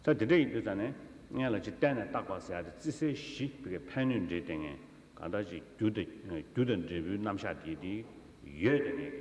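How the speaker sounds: ordinary talk, nothing marked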